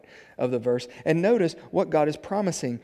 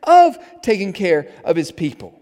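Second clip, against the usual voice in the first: loud, high-pitched pulpit proclamation; loud, emphatic sermon delivery; forceful preaching; 8 semitones higher, 12 dB louder than usual. Real, not acted.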